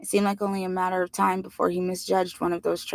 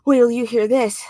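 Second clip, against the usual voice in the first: out of breath excited; 5 semitones higher, 7 dB louder than usual. A narrator's reading. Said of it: "excited and out of breath".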